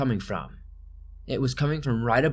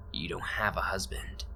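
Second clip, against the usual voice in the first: low, breathless threatening whisper; menacing whisper; 3 semitones lower, 8 dB quieter than usual. Retold or imitated. Intruder taunts the mother through the door, whispering. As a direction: hiss it softly to unnerve her.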